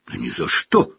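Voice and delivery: ordinary delivery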